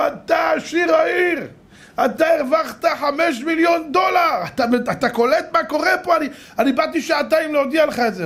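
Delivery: hammed-up high-pitched jubilation; excited announcement. Lottery seller telling a man he won millions, excited.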